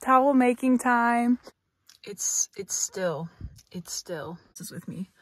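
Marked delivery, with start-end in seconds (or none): 2.0-5.1 whispering. Real, not acted. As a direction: breathe the words, barely voiced. Whispering to camera, quiet moment.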